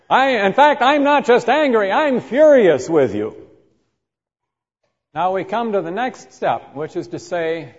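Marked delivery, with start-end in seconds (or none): 0.1-3.4 loud, high, building fury; furious outburst. Acted. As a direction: let the anger boil over. Acted escalating anger.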